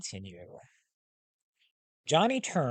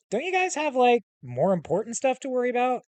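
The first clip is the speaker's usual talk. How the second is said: incredulous; 11 semitones higher, 5 dB louder, faster than usual. Acted. Incredulous boy question.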